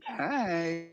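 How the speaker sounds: put-on high, stretched Valley Girl hello; lilting Valley Girl greeting. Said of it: "kinda like a Valley Girl".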